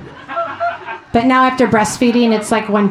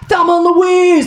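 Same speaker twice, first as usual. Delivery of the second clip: higher, cheering fan shout; mock scream; 7 semitones higher, 4 dB louder than usual. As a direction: yell it like a rowdy Ohio crowd. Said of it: "they're screaming".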